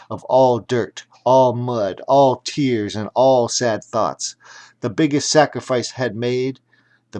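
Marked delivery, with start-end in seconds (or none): none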